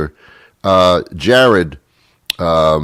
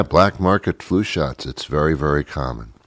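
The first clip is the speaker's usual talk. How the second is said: normal talking voice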